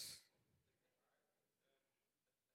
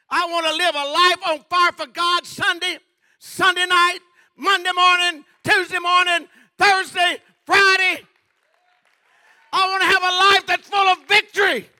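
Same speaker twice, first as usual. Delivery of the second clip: much higher and louder, shouted; preaching at full force; 12 semitones higher, 21 dB louder than usual. Acted. Preacher crying out his wish to the church, shouting. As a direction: cry out the longing with full power.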